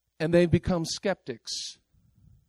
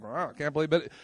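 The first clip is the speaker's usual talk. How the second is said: mild, understated sarcastic wow; a lightly mocking, quiet wow; 5 semitones lower, 6 dB quieter, faster than usual. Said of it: "It's like a sarcastic".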